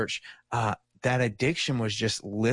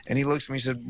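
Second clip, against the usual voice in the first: ordinary talk, nothing marked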